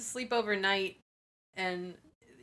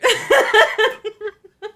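loud laughter; 11 semitones higher, 12 dB louder than usual. Real, not acted. Laughing at dad's joke.

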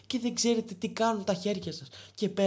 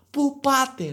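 louder, higher shout; exasperated yell at people walking around; mock yell; 4 semitones higher, 8 dB louder, much slower than usual. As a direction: call out where are you going. Yelling at wandering clubbers.

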